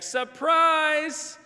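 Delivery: loud, high group cry of surprise; surprise shout. Retold or imitated. Youth group springs a surprise party, shouting. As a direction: burst out at the reveal.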